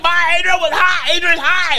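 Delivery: high, hurried tattling call; high mock tattle yelled out; calling out to mom. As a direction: snitch to mom from the car window.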